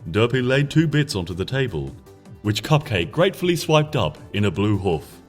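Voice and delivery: ordinary delivery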